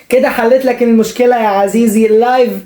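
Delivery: ordinary talk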